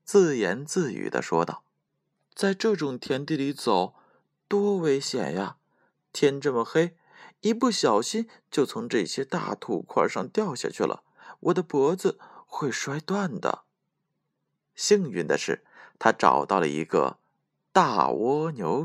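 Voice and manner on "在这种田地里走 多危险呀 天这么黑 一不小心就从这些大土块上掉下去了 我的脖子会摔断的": high, softened worried self-talk; small anxious voice, talking to oneself; a little afraid. A narrator's reading. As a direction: fret aloud to yourself, mildly scared. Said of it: "他有点害怕了，自言自语地说道".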